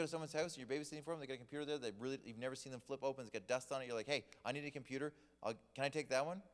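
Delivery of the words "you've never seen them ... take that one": ordinary delivery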